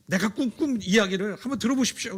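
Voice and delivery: 들떠서 높고 크게 외치듯; 자랑하고 싶어 못 견디는 소년처럼; 흥분한 요셉. Acted. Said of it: "막 흥분해서 얘기합니다". (형들에게 꿈 자랑하는 요셉, 들뜬 목소리)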